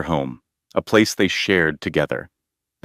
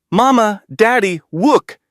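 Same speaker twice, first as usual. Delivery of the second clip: put-on much higher, louder child shouting; a thrilled little girl showing off her drawing; excited yell; 13 semitones higher, 4 dB louder than usual. Emma yelling to her parents about her drawing, high and loud. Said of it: "yelled with excitement".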